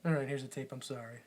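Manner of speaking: low, small mock-sorry voice; tearful kid. Crying son apologizes to his dad, meek and soft. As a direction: hand it over meekly, very soft. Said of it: "'Cause Max starts crying".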